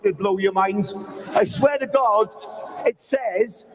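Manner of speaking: ordinary talk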